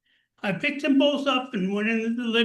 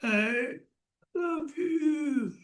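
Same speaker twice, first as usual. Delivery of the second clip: imitating dad; 4 semitones higher, 3 dB quieter, much slower than usual. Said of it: "say, 'I love you.'".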